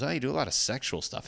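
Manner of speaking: lightly mimicking the headliner's remark; mock older comic. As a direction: toss it off like a smug old comic. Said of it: "he goes".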